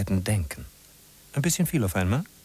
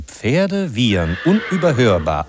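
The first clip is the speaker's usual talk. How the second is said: louder and higher, building insistence; emphatic proclaiming; 7 semitones higher, 7 dB louder than usual. A narrator's reading. Narrator insisting to listeners the horses are heard. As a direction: announce it over the city noise.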